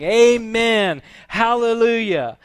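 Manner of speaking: high, loud proclamation of praise; elders' worship cry. Acted. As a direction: call it out to the throne.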